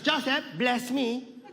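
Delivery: high, slow, demanding grandmother; Lola Tinay. Retold or imitated. Grandmother demands a blessing as grandson leaves, high and insistent. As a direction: command it high, drawn out and insistent. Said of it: "And she goes".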